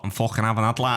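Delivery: mock Scouser, broad Liverpool vowels; Scouse bloke grabbing a chance; Scouse accent. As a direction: put on a cocky Scouse lad. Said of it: "Because he's a Scouser".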